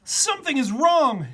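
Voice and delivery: high, loud alarmed shout; frantic zookeeper voice. A narrator's reading. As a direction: cry out in panic. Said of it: "he shouted".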